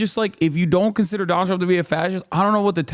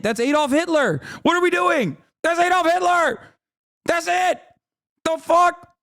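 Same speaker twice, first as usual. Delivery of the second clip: exasperated outburst; 11 semitones higher than usual. Real, not acted. Exasperated rant about fascism.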